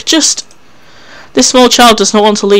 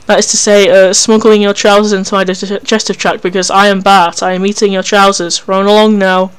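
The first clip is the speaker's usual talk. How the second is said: normal talking voice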